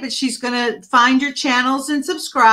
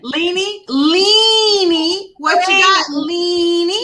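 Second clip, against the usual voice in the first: high, lilting call of her name; a host calling on Leanie by name in a sing-song way; 5 semitones higher, slower than usual. Real, not acted.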